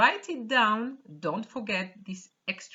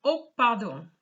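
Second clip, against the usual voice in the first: apologetic gentleman; 6 dB louder than usual. Shown as a demonstration. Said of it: "I'm just going to read the dialogue".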